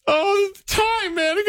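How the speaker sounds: very high, hammed-up crying voice; mock tearful wail. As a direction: squeak it out as if choking up. Retells breaking down crying at commercial.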